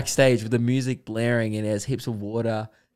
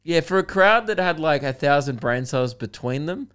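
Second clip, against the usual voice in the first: ordinary delivery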